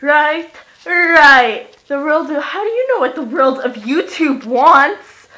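loud and high, hyped; excited rant. Real, not acted. Vlogger hyping up to the camera, loud and high. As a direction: pump up the viewers.

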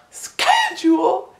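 schedule high, sk-edule stretched; high slow schedule. Shown as a demonstration. Coaching viewers on schedule. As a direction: lift it and drag schedule. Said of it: "try it with me now".

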